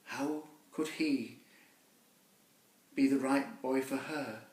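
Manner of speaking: ordinary delivery, nothing marked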